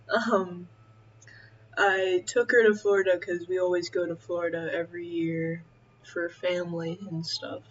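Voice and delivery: ordinary delivery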